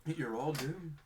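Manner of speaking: doom-laden warning, slowly; slow ominous raspy drawl. Retold or imitated. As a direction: croak it like a prophet of doom. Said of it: "he says the famous line".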